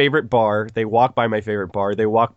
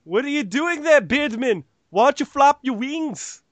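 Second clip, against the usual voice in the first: playful fake Scottish accent, pitched higher; mock Scottish; 12 semitones higher than usual. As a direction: tease the bird man in a cartoon Scottish voice. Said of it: "Like they're just Scottish".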